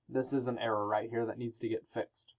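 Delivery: whisper. Real, not acted. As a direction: whisper it as a teacher's aside.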